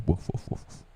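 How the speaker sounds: whispered dog barks; voiceless woof. Pretend. Lone wolf self-pity, whispered barks. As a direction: breathe out little barks.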